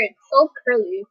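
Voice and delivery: ordinary talk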